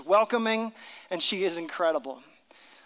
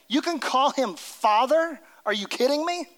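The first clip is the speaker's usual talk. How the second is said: higher, incredulous outburst; mock disbelief; 5 semitones higher than usual. Crowd hearing Jesus call God Father, incredulous. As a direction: play the scandalized listener. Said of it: "they can't believe what he actually said".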